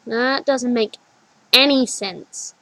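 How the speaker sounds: slow, firm, emphatic. A narrator's reading. Child insists to her older self, emphatic. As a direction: lean on each word. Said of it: "she says emphatically".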